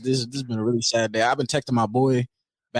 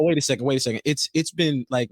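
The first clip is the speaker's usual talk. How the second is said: usual speaking voice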